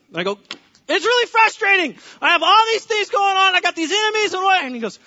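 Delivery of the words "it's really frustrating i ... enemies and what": high, loud, worked-up protest; preacher playing himself pleading with God; frantic rant. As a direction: rant like the world is on fire. Acted frantic self to God.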